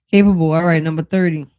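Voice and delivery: sudden loud burst on one word; loud exclaim. Real, not acted. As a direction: pop the word out like a sudden discovery.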